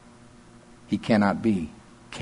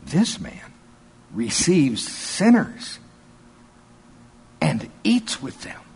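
higher-pitched, slow, scandalized disdain; 4 semitones higher, slower than usual. Acted. Pharisees murmuring about the company he keeps, with disdain. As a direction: draw out each phrase in haughty disapproval. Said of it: "Can't you just hear the disdain in their voice?".

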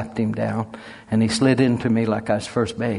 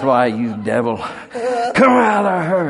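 loud, slow, high cry ordering the devil out; commanding shout; 7 semitones higher, 5 dB louder, slower than usual. Retold or imitated.

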